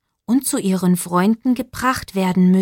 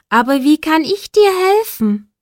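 put-on young Max asking; 7 semitones higher, 4 dB louder than usual. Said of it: "fragte Max".

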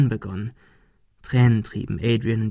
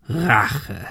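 mocking gunman. A narrator's reading. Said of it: "Der Mann lachte Ben aus".

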